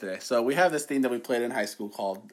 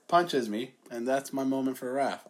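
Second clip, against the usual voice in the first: ordinary talk, nothing marked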